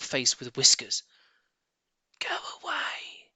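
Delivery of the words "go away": high hissed whisper of a cross rat; a rat snapping from its hole. A narrator's reading. Rat hissing at the digging foxes to leave. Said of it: "it snapped".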